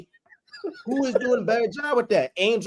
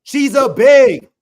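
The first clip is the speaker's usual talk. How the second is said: slow, loud, drawn-out "big"; emphatic shout; 6 semitones higher, 10 dB louder, much slower than usual. Real, not acted. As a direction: lean hard on "big", slowly. Arguing about physicality, emphatic.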